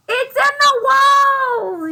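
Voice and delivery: slow loud high shout. Acted. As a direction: yell it out, frantic. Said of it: "yelled Owl".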